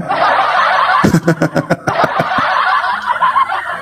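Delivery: big high burst of laughter. Real, not acted. Preacher laughing at the heckler's insult to the crowd. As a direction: throw back your head and laugh.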